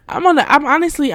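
buzzing with excitement; giddy. Real, not acted. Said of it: "I'm on a mental high".

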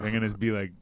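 usual speaking voice

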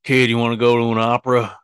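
strange man. Acted. Stranger inviting a kid to the opera, lightly voiced. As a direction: play a strange man making an offer. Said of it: "It was some strange man said".

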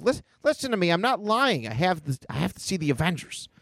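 put-on high, urgent kid voice; pissed-off boy. Boy insists to the butler, acted high-pitched anger. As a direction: plead angrily, pitched well up. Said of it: "he's pissed".

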